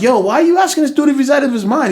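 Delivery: high-pitched retold protest; exasperated, high-pitched objection; incredulous scolding. Teller scolds friend for confronting stranger.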